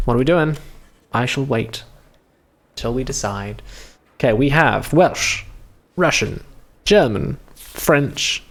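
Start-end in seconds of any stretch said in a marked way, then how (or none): none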